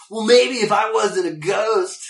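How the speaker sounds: loud, high, slow mocking drawl; hammed-up sarcastic cartoon hero; Link, sarcastic. Imitating the sarcastic cartoon hero as a ghost, mocking. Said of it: "Link is so sarcastic".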